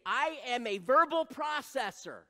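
loud, high proclamation; mock grand announcement; acted self-declaration. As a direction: declare the label emphatically. Acting out a self-label.

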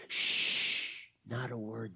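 soft, low shushing; hushed warning. Acted. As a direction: hush them so nobody is discovered. Children hiding from approaching men.

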